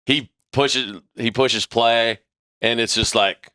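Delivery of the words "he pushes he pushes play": ordinary delivery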